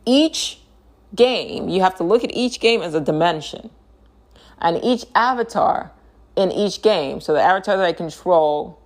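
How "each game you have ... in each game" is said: each word hit high and separate; slow emphatic repeat. Shown as a demonstration. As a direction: spell it out slowly, word by word. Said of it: "I'm gonna say that again".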